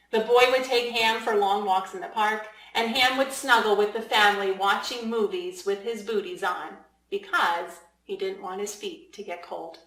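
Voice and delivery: ordinary delivery, nothing marked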